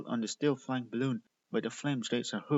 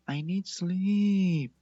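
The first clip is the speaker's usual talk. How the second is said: louder, slow, higher sleepy whine; grumpy groan; 6 semitones higher, 7 dB louder, slower than usual. A narrator's reading. Sleepy Candy grumbling at her sisters, whiny. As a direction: drag the words out, annoyed. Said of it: "waved annoyedly at their faces and mumbling".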